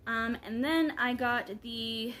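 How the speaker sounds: ordinary talk, nothing marked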